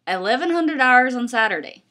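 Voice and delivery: a hammed-up bad Irish accent; terrible Irish accent. Miles telling Julian the time, bad Irish accent. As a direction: do a clumsy Irish brogue. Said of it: "in my terrible Irish accent".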